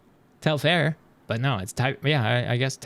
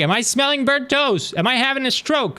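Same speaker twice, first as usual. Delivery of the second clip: mock alarm; 10 semitones higher, 6 dB louder than usual. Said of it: "so you're like".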